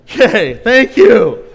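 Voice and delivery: loud awkward wrap-up. Retold or imitated. Pastor ending the awkward chat, loud and flustered. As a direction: close it off loudly, embarrassed.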